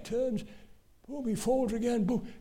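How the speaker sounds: usual speaking voice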